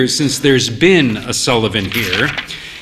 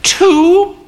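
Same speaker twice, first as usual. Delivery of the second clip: much higher, louder questioning lady; an old mother ordering from the barman, higher; 4 dB louder than usual. A narrator's reading. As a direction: pipe up as the grand old mother.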